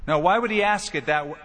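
ordinary talk

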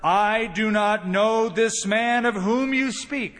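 loud, high, slow sworn denial; cursing denial. Acted. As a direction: swear it forcefully, drawing out each word. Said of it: "he began to curse ... and swear".

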